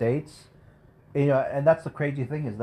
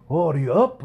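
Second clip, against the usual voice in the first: put-on higher, louder accented greeting; lightly voiced Japanese old man; 6 semitones higher, 6 dB louder than usual. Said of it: "stereotypical Japanese accent".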